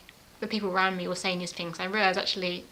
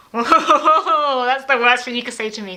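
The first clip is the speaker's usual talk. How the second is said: loud, higher whoa of disbelief; shocked outburst; 4 semitones higher, 11 dB louder, slower than usual. Retold or imitated. Wife hits back at husband's dinner remark, loud and stunned. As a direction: blurt it out, stunned.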